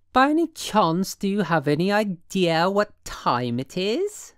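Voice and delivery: put-on high, affected English accent. A narrator's reading. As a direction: put on grand English airs. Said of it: "getting an English accent".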